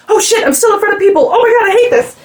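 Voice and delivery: high, loud panicked mock aside; mock mortified singer. Singer caught in front of the crowd, mock panicked high voice. Beth snapping back, mortified.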